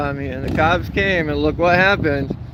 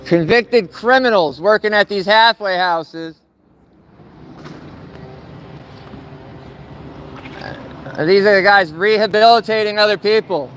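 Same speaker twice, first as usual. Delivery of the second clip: protest shouting; 7 semitones higher than usual. Real, not acted. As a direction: bellow it like a protest chant. Protest chant at parole office.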